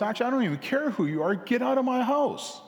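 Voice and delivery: fast, high, hammed-up order to leave; mock angry husband. Husband ordering the stranger out of the house, fast mock anger. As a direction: throw him out fast.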